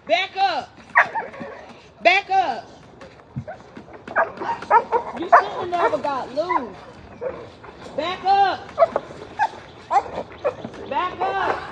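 firm repeated command to back away; warning off. Real, not acted. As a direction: shoo something off sternly. Telling something to back off.

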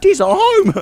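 high-pitched parroting; mocking boy mimicry. Streamer echoing Tommy's welcome, high mocking mimicry. Parrots the game boy's line.

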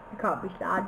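ordinary talk, nothing marked

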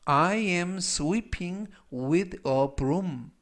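very slow, word-by-word English model; slow, deliberate teaching read; language-lesson model reading. Shown as a demonstration. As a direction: read it out slowly for learners to repeat.

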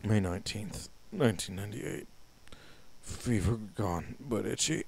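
turning zombie. Acted. Reading the infected keeper's last entry to viewers, softly fading. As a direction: let the mind drain away as you read.

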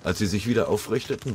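ordinary delivery

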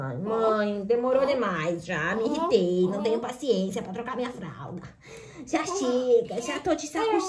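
put-on low, fast whiny baby complaint; mother voicing her crying baby's protest. Mother voices fussing baby's complaint.

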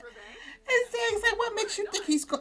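high giggling through the words; laughing. Real, not acted. Voicing Zane mid-laugh, high and giggly. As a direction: laugh while starting the quote.

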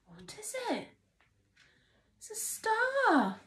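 high, hushed amazement; wonder-struck. Acted. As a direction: gasp over a new toy. Toy reveal to toddler.

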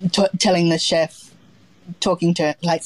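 ordinary delivery

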